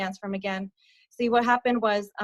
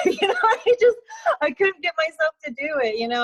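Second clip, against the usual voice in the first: laughing nervously; 7 semitones higher, 5 dB louder than usual. Real, not acted. Nervous laugh recounting refusal.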